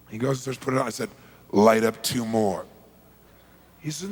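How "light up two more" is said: slow, low-pitched order; measured, controlled instruction; calm command. Retold or imitated. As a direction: state it slowly and evenly. Calm order before screaming.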